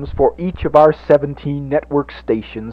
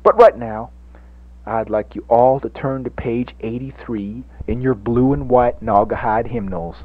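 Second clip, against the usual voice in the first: ordinary talk, nothing marked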